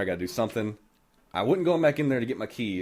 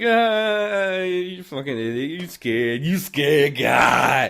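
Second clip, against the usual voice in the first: drawn-out taunting imitation; slow sing-out jeer, swelling louder; mock jeering; 4 semitones higher, 5 dB louder, much slower than usual. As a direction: stretch it out, teasing like mocking relatives. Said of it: "they fucking start laughing".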